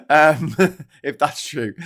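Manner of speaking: laughing through the words. Real, not acted. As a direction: giggle out if that's true. Laughing at Wes crying.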